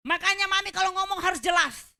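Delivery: high, very loud mock scolding; shouted retort. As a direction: yell back at mami like an exasperated maid. Acted maid's angry retort to employer. (bentakan lantang bernada tinggi, menirukan pembantu)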